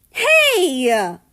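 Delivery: loud, sharp, indignant hey; offended shout. A narrator's reading. America yelling at Canada for calling him a pig. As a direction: yelp at the insult.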